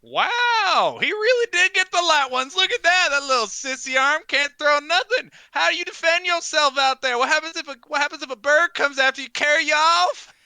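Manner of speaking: loud, high-pitched taunting yell; jeering heckler. Acted. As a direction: heckle at full voice from the crowd. Game master voices a heckler.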